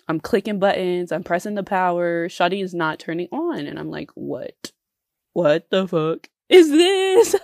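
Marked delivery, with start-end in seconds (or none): 4.1-7.3 shocked. Retold or imitated. Retold reaction to computer crash.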